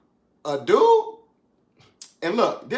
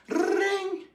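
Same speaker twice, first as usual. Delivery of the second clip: high sung phone ring imitation. Preachers calling him, a mock high phone ring. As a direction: mimic a ringing phone, high and long.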